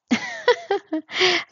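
a light, high-pitched chuckle; high amused laugh. A narrator's reading. Tobias laughing at Regulus's dry reply, high and light. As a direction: laugh off the rebuff. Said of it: "Tobias laughs".

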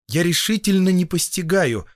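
agitated. A narrator's reading. Young doctor to his colleagues by the stove, agitated. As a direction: protest in agitated disbelief. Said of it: "заговорил я возбуждённо".

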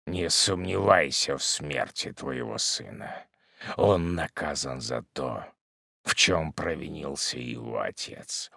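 low, slow, soft verdict; hushed, heavy solemnity; grim hermit. Acted. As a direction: pronounce doom quietly and deliberately. Hermit pronounces judgment.

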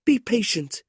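high, urgent plea; begging. A narrator's reading. Kate begs her angry brother, high and urgent. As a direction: beg him gently but urgently. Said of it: "Kate begged him".

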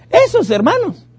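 high, sneering gossiping neighbour; mocking gossip. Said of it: "Cuando la gente dice".

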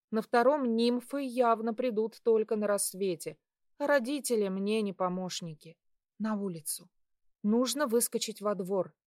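ordinary delivery, nothing marked

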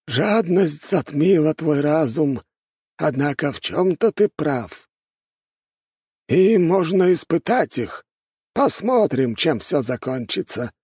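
high, slow elder voice; scheming old master. A narrator's reading. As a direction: pronounce it slowly in a high, lofty voice.

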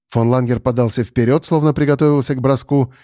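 ordinary delivery

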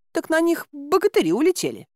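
high-pitched voice of a village woman; Аглая. Acted. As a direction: answer in a high, lilting character voice. Рассказчица озвучивает Аглаю. (высокий голос женщины-персонажа)

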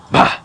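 short exasperated gnome cry; cried "Bah!". A narrator's reading. Gnome kicking his ink jar, exasperated cry. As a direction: burst out in disgust. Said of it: "cried the gnome".

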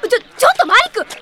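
high, loud startled protest; alarmed outburst. Acted. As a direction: stop him in shock. Marik heads into enemy building.

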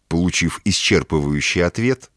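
ordinary delivery

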